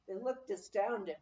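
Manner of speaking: plain reading voice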